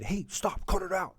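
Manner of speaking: a student snapping at a classmate; hint of an annoyed kid; mock telling off. Student tells classmate to stop.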